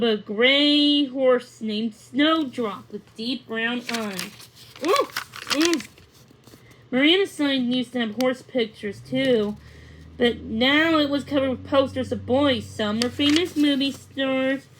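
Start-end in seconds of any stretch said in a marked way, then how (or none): none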